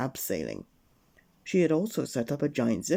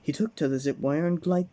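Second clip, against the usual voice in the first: ordinary delivery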